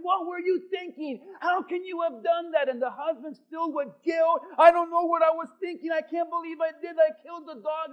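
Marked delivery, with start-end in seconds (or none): 0.0-2.7 mild mock yell. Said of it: "the wife is yelling at the husband". 4.6-7.9 louder, fast, frantic confession; guilt-stricken husband. Acted. Husband admits to wife he killed the dog, frantic.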